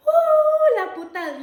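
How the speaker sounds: high, loud whooping call; imitating cops shouting from the car; mock cop call. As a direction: holler it like the cops calling out. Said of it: "you know, the cops, when they call her".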